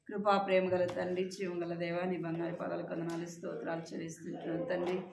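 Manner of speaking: teacher leading the class in prayer; soft prayer. Acted. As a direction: pray quietly and reverently.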